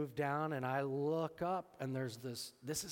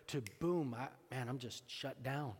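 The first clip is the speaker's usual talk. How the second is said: normal talking voice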